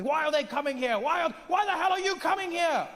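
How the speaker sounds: high-pitched indignant British whine; mock British grumbler, worked up; complaining British people. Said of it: "British people are complaining, going".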